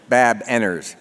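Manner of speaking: ordinary delivery, nothing marked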